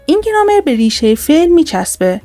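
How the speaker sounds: ordinary delivery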